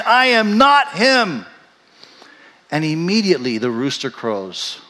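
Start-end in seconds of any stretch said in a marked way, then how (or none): 0.1-1.5 high, slow, forceful denial; acted indignant protest; vehement cursing denial. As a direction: swear it emphatically, word by word. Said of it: "call down curses from heaven".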